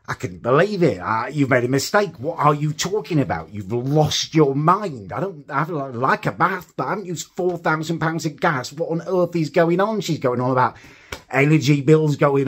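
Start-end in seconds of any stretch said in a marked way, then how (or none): 1.3-10.0 higher, incredulous protest; exasperated disbelief at the caller; building indignant retelling; retold outrage.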